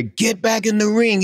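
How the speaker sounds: high, yelled order to get back in; cussing yell. Retold or imitated. Timekeeper yells at the fleeing referee, high cussing shout. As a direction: bark it from ringside at the runaway ref. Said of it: "He's cussing me".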